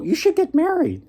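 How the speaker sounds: a lightly squeaky, high-pitched little fox. Retold or imitated. Fox urging the poor woodsman to marry, high voice. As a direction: chirp it up high like a small clever animal.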